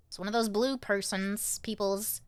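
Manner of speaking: usual speaking voice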